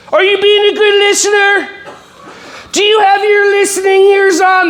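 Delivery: hammed-up preschool teacher nagging; mock teacher scold. Teacher nagging a kid about listening, high sing-song.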